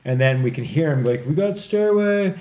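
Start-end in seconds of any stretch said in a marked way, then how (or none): 1.3-2.3 high, louder mock police shout; mimicked shout to the team; imitated cop call-out. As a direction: call out like a raiding officer. Imitating police raiding the house.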